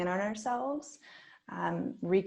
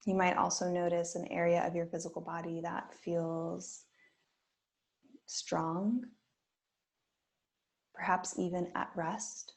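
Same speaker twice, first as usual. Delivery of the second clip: guided meditation. Acted. Yoga teacher guiding students through a body scan, gently. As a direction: ease listeners into stillness with long pauses.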